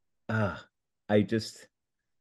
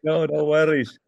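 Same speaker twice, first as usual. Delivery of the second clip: higher Scottish brogue, forgiving; Scottish accent; 6 semitones higher, 4 dB louder than usual. Acted. Scottish character reassures the apologising host. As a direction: wave it off in Scottish. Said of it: "a Scottish Brian".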